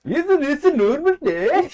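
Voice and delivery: high, loud, singsong mock-Swedish; Swedish chef. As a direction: go full cartoon Scandinavian accent. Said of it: "Swedish chef".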